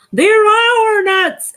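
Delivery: high, loud, slow wail; high, slow, loud protest; exclaimed. Acted. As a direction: cry out at the lost nuts. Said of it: "exclaimed Bruce".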